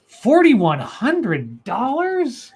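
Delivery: slow, drawn-out disbelief; aghast at the repair bill; incredulous. Real, not acted. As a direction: stretch the price out in shock. Incredulous repeat of price.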